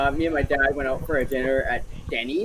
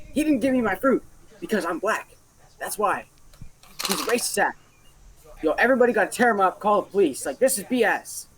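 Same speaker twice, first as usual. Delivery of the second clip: mock yell; 5 semitones higher than usual. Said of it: "screaming at the top of her lungs".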